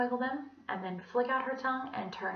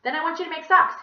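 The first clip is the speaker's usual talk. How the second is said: loud, firm teacher command; bossy order; 8 semitones higher, 11 dB louder, faster than usual. A narrator's reading. Teacher ordering the class to knit, loud and firm. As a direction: order the kids to work.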